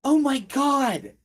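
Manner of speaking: high, slow horrified shout; younger voice shouting. Acted. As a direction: scream at the blood. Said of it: "the younger voice shouting".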